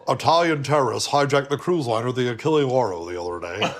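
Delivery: swallowed-L news anchor, sinking at the end; mock anchor with mushy Ls; Tom Brokaw impression. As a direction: read the news without pronouncing any L. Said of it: "how Tom Brokaw never said the letter L".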